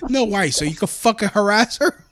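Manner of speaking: high, loud exasperated comeback; mock-outraged challenge to a pest; retold indignant refusal. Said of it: "I'm like".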